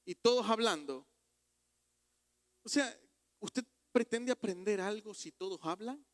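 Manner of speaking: rising sermon fervour; full-force preaching. Acted. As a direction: paint the noisy table for the congregation. Preaching, imagining Jesus at table.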